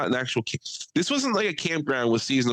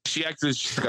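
ordinary talk

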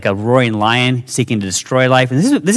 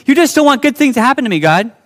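whiny complaint; 11 semitones higher, 3 dB louder, faster than usual. Retold or imitated. Jeff arguing back with God.

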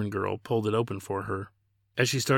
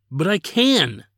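sudden, louder burst, pitch jumping up; blurted out; 7 semitones higher, 8 dB louder, slower than usual. A narrator's reading. Prince stopping Kimber at the curtain, louder sudden blurt. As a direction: blurt it out before she leaves. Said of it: "Ladin blurted".

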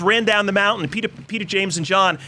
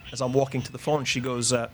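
usual speaking voice

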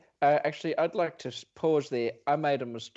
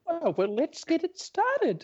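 high, squeaky character voice; high caller voice; 12 semitones higher than usual. Acted. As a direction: play a silly caller. Plays caller Monotony.

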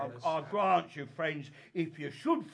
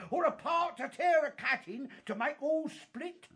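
normal talking voice

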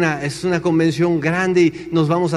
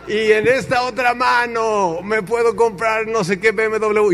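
high, slow showman's brag; boastful American speaker; 5 semitones higher, slower than usual. Retold or imitated. American showing his other hand, high boastful proclamation. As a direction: proclaim it grandly, drawing it out.

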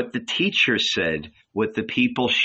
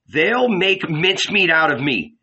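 higher-pitched, mildly whiny protest; whining; 6 semitones higher than usual. A narrator's reading. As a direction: make a plaintive excuse. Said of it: "Peter whined".

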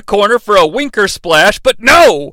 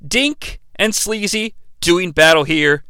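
normal talking voice

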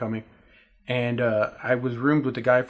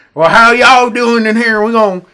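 loud, high, slow hammed-up Texas drawl; exaggerated Texas accent; 7 semitones higher, 12 dB louder, slower than usual. Mocking outsiders who imitate Texans, exaggerated Texas accent. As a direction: stretch it out in a booming fake Texan accent. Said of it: "someone from Texas and they come in".

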